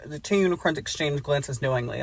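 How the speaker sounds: ordinary talk, nothing marked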